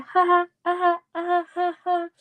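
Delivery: high far-off put-on cackle of a laugh; mock laughing. Retelling Sookie hearing the queen title, mock laughing. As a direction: ham up a silly ha ha ha. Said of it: "Sookie starts laughing".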